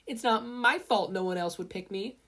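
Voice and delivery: put-on girl snapping back in a squabble; whiny inventor girl. A narrator's reading. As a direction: pout it out, sulky and sore. Mei arguing with Mina.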